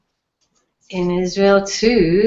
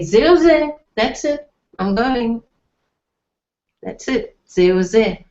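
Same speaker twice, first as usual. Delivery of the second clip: imitating upset person; 4 semitones higher, 3 dB louder than usual. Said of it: "people getting really upset".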